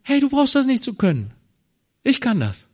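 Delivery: high, loud, fast reassuring voice of God; God speaking. Acted. God answers the discouraged believer, high, quick and loud. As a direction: brush the worry aside as God would. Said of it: "Wisst ihr, was Gott sagt?".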